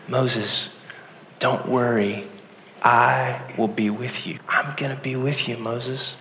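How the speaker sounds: soft, hushed reassurance; calm them softly, dropping almost to a murmur; gentle reassurance. Acted. God comforting a doubting Moses at the bush, soft reassurance.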